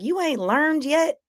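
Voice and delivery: high, mocking taunt. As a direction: tease him from way up high. Taunting Corey in the street.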